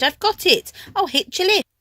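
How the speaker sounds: high, loud and thrilled; a sudden bright idea, called out; excited cry. A narrator's reading. Tiny snail announcing her plan, high and excited. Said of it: "then cried".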